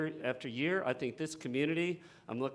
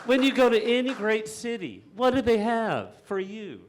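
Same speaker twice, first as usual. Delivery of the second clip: raised, higher voice, swelling with real passion; emotional outburst; 8 semitones higher, 8 dB louder than usual. Real, not acted. Council candidate pleading for city rail, emotional raised voice. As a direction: let the frustration burst out loud. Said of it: "I'm getting a little emotional".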